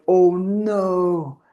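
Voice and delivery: high, loud, sudden realisation of a mistake; retold gasp of regret at yelling; dismayed oh no. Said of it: "and then I was like".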